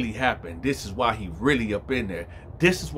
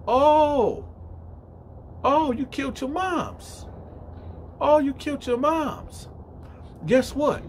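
very high-pitched acted outrage; drawn-out, incredulous retold accusation; outraged discovery; slower than usual. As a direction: act out shocked confrontation. Inmate discovers the crime.

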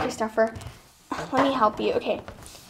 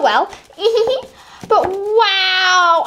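ordinary talk

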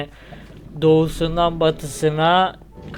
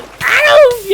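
loud, high-pitched scream; sudden piercing "au" of alarm; startled yelp; 10 dB louder than usual. Real, not acted. Gamer hit by danger mid-level, startled high yelp.

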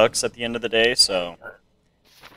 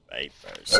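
much lower, gruff inspector barking papers; deep guard voice; 11 semitones lower, 4 dB quieter than usual. Acted. Gamer voicing the entrant, deep mock voice. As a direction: drop the voice deep like a border guard. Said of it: "I'll just do a deep voice".

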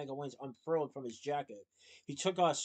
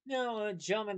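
sheepish; 6 semitones higher than usual. Acted. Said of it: "the priest said sheepishly".

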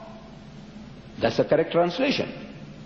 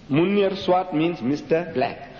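usual speaking voice

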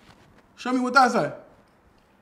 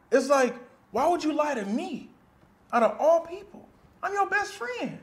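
hurt accusation; 7 semitones higher than usual. Acted. Hurt friend accuses of lying.

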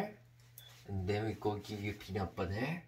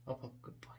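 soft whispered up, up; whispering the dog up the stairs; whispered coaxing; 10 dB quieter, much faster than usual. Real, not acted. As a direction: hush the dog upward gently.

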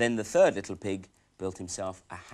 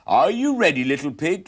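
loud, higher wolf call; 7 semitones higher, 11 dB louder than usual. Acted. Wolf calls at door.

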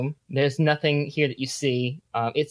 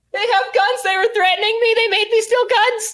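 very high panicked scream; 5 dB louder, faster than usual. Acted. Game master as Johnny running to his parents, panicked scream. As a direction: scream it frantically, like a kid in terror. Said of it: "he screams".

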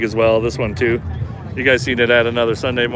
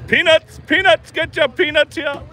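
street vendor calling; 14 semitones higher than usual. Pretend. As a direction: hawk peanuts like a stadium vendor. Mock stadium vendor cry.

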